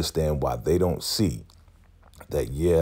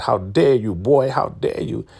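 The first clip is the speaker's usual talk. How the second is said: higher, louder mock outrage; indignant; 7 semitones higher, 4 dB louder than usual. Marva scolding Malcolm, mock outrage. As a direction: scold him like a child. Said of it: "He's like".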